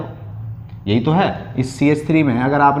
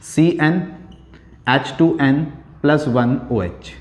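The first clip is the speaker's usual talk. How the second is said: slow spelled-out formula; much slower than usual. Shown as a demonstration. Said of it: "कैसे apply करते हैं देखिए".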